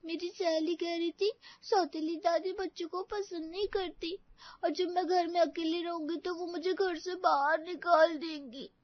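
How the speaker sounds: usual speaking voice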